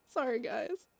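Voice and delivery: real laugh breaking through, near tears; laughing-crying apology. Real, not acted. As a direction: giggle helplessly through the words. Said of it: "Beth is so nuts".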